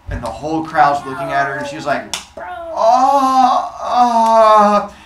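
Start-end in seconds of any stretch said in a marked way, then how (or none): 2.4-4.9 loud, high, stretched-out ohs; embarrassed realization. Acted. Athena seeing the whole crowd watching, loudly embarrassed. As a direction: get caught and draw it out. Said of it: "she has that embarrassing moment".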